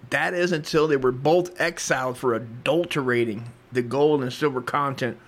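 ordinary delivery

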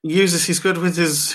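ordinary talk

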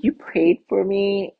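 high-pitched, surprised question; touched surprise. Retold or imitated. Passenger to driver who said a prayer, surprised.

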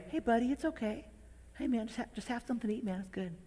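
put-on soft, high and fast, like calming a toddler; gentle coaxing. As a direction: sweet-talk a little kid into eating.

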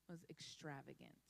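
quiet reveal. Real, not acted. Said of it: "the word the Lord gave me".

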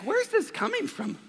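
high, slow, squeaky mock bewilderment; mock bewildered. Pastor imitating the repairman's baffled thought, high and slow. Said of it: "And I'm like".

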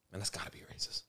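whispered aside to his dad; hushed unvoiced aside. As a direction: breathe it under your breath so no one hears. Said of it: "That's how he said that shit".

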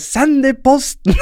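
high-pitched, drawn-out mock yell; retold defiant shout. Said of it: "roper jeg bare".

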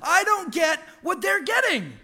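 high, shouted wail of a jealous little one; toddler tantrum. Retold or imitated. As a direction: pretend to be a toddler crying out that it's unfair. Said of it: "he's like".

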